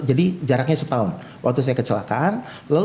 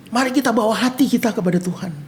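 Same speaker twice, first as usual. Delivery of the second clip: high, lifted exhortation to a congregation; worship leader; 8 semitones higher than usual. Retold or imitated. Worship leader calling the congregation to God, exhorting. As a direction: imitate a worship leader urging everyone on. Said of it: "Si worship leader gini".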